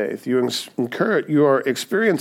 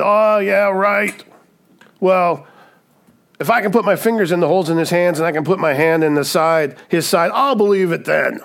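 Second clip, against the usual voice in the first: raised pitch, mocking disbelief; sarcastic scoffing; 5 semitones higher, 3 dB louder than usual. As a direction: scoff at a ridiculous claim. Thomas scoffs with an impossible condition.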